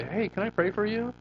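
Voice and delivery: high, self-mocking awkward offer; a goofy, too-eager hello; awkward mock approach. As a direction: play the clumsy stranger at the store. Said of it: "I'm just gonna look really stupid if I".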